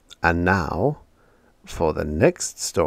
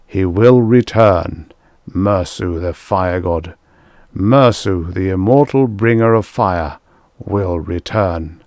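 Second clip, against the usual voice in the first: intoning; 6 dB louder than usual. A narrator's reading. Priests over their altars at sunset, intoning. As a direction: proclaim it as a liturgy. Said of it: "stand over their altars and intone".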